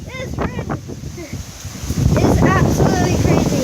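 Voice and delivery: loud, high over the gale; shouting over wind. Real, not acted. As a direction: yell it over the roaring wind. Vlogging outside in hurricane wind.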